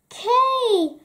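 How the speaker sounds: loud, high excited call. A narrator's reading. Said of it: "she called".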